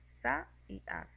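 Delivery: "sa y ac" dicho despacio, sílaba por sílaba; sílabas separadas. Shown as a demonstration. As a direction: separa "sa" y "ac" como en un dictado lento. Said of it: "y forman dos sílabas". (pronuncia "sa y ac" separando las sílabas)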